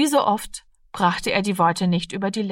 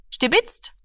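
much higher, abrupt blurt; sudden blurted confession; 9 semitones higher than usual. A narrator's reading. Rami to Frau Lenzen about the orange, blurting it out. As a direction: let the word slip out suddenly. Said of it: "Plötzlich entschlüpfte ihm".

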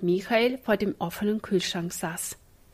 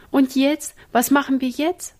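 higher, louder helpless questions; at a loss; 6 semitones higher, 5 dB louder than usual. A narrator's reading. Ina asks the boys what to do, helpless. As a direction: ask with no idea what next. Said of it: "fragte Ina ratlos".

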